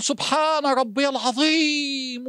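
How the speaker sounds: very slow, drawn-out, reverent recitation; savoring the words. Shown as a demonstration. Preacher modelling mindful bowing prayer, slow and savoring. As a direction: stretch each word as if seeing the universe. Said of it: "وكأنك شايف الكون قدامك".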